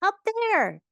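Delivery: high-pitched, anxious little creature; high, falling, fearful cry; frightened Piglet. A narrator's reading. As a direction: squeal it out in alarm. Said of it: "said Piglet".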